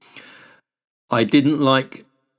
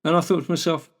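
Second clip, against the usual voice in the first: ordinary delivery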